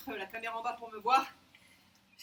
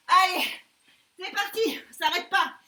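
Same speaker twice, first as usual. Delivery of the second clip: shouted countdown; 4 semitones higher, 7 dB louder, slower than usual. Real, not acted. Shouting final countdown of burpees.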